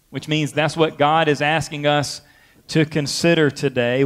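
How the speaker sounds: usual speaking voice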